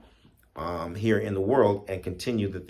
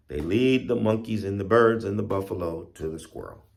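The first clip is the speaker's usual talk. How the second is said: plain reading voice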